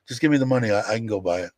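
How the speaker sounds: normal talking voice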